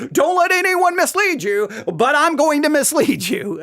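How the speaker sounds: high, sing-out parody of a preacher; mock preacher. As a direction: mimic a preacher's warning in a high, mocking voice. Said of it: "I find it just absolutely hilarious".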